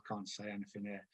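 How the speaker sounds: very soft, low aside to yourself; retold timid thought, barely voiced; quiet inner thought. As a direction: keep it small and hushed. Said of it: "I'd sit down quietly".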